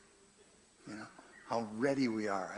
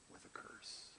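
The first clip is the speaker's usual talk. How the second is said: dramatic whispered ending; 18 dB quieter than usual. Acted. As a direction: drop to a hushed whisper. Dramatic close of scripture reading.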